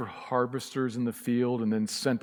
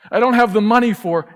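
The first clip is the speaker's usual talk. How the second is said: loud, high plea of a believer short of money; worried believer; 9 semitones higher, 13 dB louder than usual. Acted. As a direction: cry out as the anxious church member.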